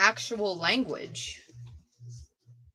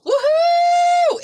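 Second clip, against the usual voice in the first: high, loud, long woohoo; celebratory squeal held on a high note; excited whoop; 9 dB louder than usual. Real, not acted. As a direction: let out a sustained cheering whoop. Cheering the new digital item.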